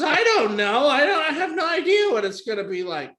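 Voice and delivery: put-on loud, high flustered girl caller; high-pitched mock bewilderment with laughter; flustered laughing. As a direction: imitate an exasperated woman laughing through it. Flustered caller answers absurd question.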